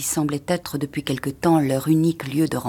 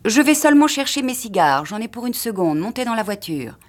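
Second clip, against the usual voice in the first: unhurried husband; 6 semitones higher than usual. A narrator's reading. Husband going back for his cigars, lightly raised character voice. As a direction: lightly play a husband stalling with a higher start.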